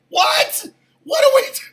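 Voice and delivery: high, loud squawk; comic outburst at his wife; hysterical disbelief. Retold or imitated. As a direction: shriek it, about to lose it laughing.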